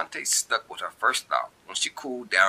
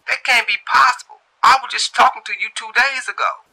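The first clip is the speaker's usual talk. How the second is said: much higher, loud insisting voice; caller insisting; 10 dB louder than usual. A narrator's reading. Caller insisting he spoke to her two days ago, high and loud. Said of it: "The voice on the other line say".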